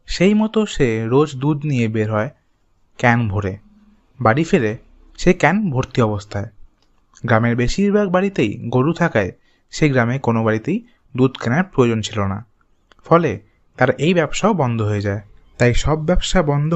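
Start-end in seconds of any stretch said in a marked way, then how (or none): none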